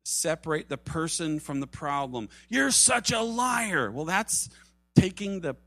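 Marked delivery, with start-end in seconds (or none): 2.5-3.9 slow, raised-pitch mock shout; mock angry accusation. Preacher mimics someone attacking the person, mock angry. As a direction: act out an outburst, drawing the words out. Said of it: "when you're angry like that".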